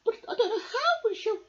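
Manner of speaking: very high, loud fussing woman's voice; shrill high worried wife voice, near tears; fretting tinker's wife. Acted. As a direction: fret and whine about the sick husband. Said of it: "asked the tinker's wife".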